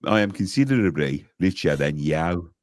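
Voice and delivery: low mock Brummie catchphrase; lightly put-on Birmingham accent; Harry Enfield character. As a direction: drop low into a Brummie boast. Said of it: "so Harry Enfield".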